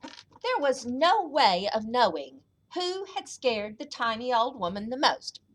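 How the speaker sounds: ordinary delivery, nothing marked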